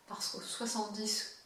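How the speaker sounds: usual speaking voice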